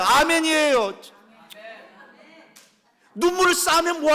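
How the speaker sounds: 높고 크게 외치는 설교; 회중을 몰아붙이듯 힘껏 설교; full-force preaching. Acted.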